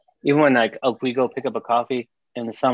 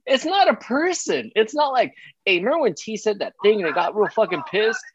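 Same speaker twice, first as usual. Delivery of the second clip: high laughing mock voice; laughing high mock of son; 9 semitones higher than usual. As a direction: imitate him high and amused. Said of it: "how you gonna be like".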